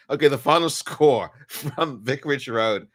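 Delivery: score read cracking up with laughter; laughing take. Real, not acted. Fan's third attempt at the score, laughing. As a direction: try to read it but laugh breaks in. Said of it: "I just cannot do this".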